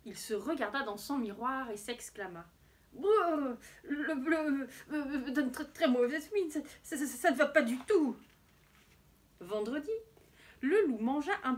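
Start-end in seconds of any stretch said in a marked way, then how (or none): 2.9-8.2 shivering stammer, loud and acted; teeth-chattering cold. A narrator's reading. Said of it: "claquant des dents". (voix grelottante, claquant des dents)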